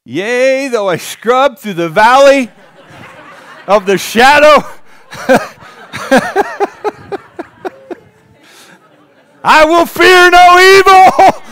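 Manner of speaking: high, loud, slow and laughing; laughing mock shower preaching. Pastor jokes about his shower sermons, laughing high. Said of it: "preach in the shower".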